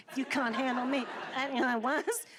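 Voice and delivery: sassy quote with own shoulders. Retold or imitated. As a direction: give it attitude, head bobbing. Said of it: "So sassy, it comes with its own set of shoulders".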